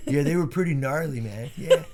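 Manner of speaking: hint of a chill surfer dude. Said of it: "talking like a, like a surfer dude".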